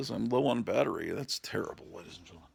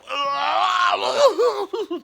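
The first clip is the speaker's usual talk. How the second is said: loud burst of laughter; 11 dB louder than usual. Real, not acted. Bursting out laughing while filming.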